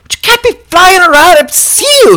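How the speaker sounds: high fussy vampire telling him off; scolding vampire. Retold or imitated.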